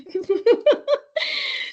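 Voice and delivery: a sudden high burst of laughter. Real, not acted. Host cracking up at a parent's Sheldon Cooper comment, high laugh.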